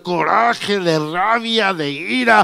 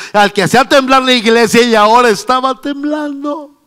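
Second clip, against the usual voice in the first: building, louder and higher sermon climax; fiery preaching; 4 semitones higher, 6 dB louder than usual. Acted. Preacher building to a shout before the congregation.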